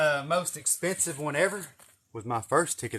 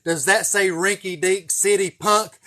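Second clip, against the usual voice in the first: quoted again slower and a bit less loud, a mock yell; trooper yell; 6 semitones higher, 11 dB louder, slower than usual. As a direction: repeat the trooper line, slow and half-shouted. Trooper line repeated as comment.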